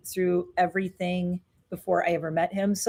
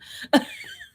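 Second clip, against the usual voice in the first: laugh through tears. Real, not acted. Teary laugh on camera.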